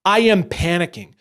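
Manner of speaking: usual speaking voice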